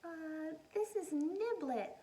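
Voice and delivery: a hint of a timid little girl.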